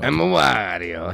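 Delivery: slow, drawn-out Wario voice; Wario impression. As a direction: stretch it out like a cartoon Wario. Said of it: "you could try out for Wario".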